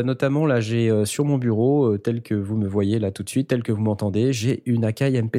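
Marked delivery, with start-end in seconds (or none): none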